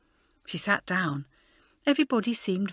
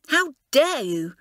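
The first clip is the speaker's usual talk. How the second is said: slow, loud, higher fury; raging; 6 semitones higher, 5 dB louder, slower than usual. A narrator's reading. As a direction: spit it out in outrage, slowly. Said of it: "raged Elizabeth".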